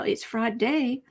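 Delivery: ordinary talk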